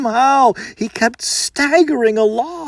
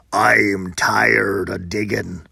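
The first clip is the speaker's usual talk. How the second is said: huge brute; 12 semitones lower, slower than usual. Acted. Huge man complains, deep voice.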